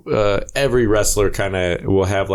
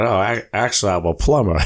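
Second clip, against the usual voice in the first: normal talking voice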